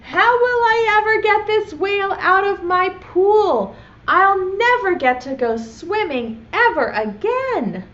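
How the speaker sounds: mild storybook frustration; frustrated boy. Acted. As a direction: lament like a kid who lost his pool. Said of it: "he looks frustrated".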